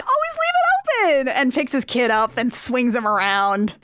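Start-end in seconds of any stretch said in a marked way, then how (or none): 0.0-1.3 much higher, playful outburst; gleeful exclamation. Retold or imitated. Dad to his kid after a fake scolding, high and gleeful. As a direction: sing it out high with joy.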